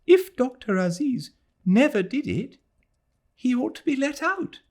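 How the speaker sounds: put-on a girl confessing to her fiancé; distraught young woman. A narrator's reading. Adela high voice.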